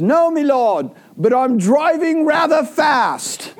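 very high, slow, put-on cabbie voice calling back; hammed-up high cabbie shout; cheerful cabbie. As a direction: squeak it out like a jolly old cab driver. Driver's answer in story.

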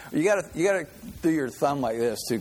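usual speaking voice

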